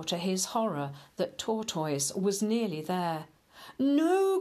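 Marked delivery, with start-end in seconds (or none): 3.8-4.4 high, loud anguished no; anguished cry. Acted. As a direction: cry out in despair. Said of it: "cried Hare".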